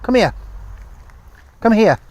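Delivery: high, loud command; loud command across the field; firm call. Real, not acted. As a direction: call her back firmly. Calling the dog back.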